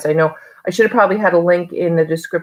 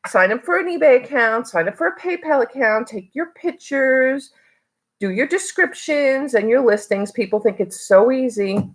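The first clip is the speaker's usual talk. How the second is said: sarcastic, much higher pitched challenge; cynical rant; 6 semitones higher than usual. Real, not acted. Vlogger mocking resellers who think eBay is easy, sarcastic and high. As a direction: dare them to try it themselves. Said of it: "Am I being too cynical".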